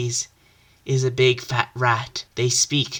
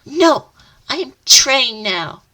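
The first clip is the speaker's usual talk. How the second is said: high determined hero; 10 semitones higher, 6 dB louder than usual. Acted. Hero answers villain.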